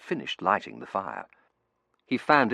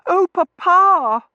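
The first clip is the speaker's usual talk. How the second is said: very high, loud, drawn-out wail; high-pitched daughterly distress; 17 dB louder, much slower than usual. A narrator's reading. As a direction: wail to Papa at the top of the voice. Daughter wailing to father.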